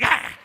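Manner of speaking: sudden loud mock attack yell; comic attack cry; attacking snarl. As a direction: lunge with a short, loud "Ah!". Imitates dog attacking crotch.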